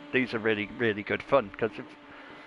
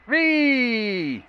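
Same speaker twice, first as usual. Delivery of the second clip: imitated drawn-out high "ree" screech; high-pitched squeal; 10 semitones higher than usual. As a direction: squeal like someone going crazy, mockingly. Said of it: "Probably said more high pitch".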